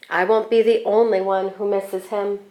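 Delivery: ordinary delivery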